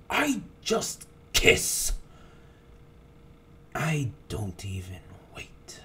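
normal talking voice

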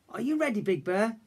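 calling cub. A narrator's reading. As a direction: call out before jumping. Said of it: "Little Bear called".

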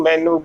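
ਮੈਨੂੰ said "mainoo"; wrong oo ending. Shown as a demonstration. Teacher showing the slip, mispronunciation. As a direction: end ਮੈਨੂੰ on "noo". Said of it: "ਗਲਤ ਉਚਾਰਨ ਹੋਵੇਗਾ".

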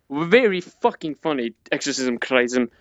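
usual speaking voice

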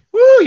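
high, quick "woo"; excited whoop. Real, not acted. Instructor cheering on the workout class, excited whoop. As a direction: whoop of effort.

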